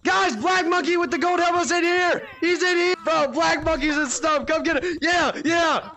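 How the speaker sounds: high, loud alert to teammates; urgent call-out. Real, not acted. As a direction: shout for backup. Calling out a player's location.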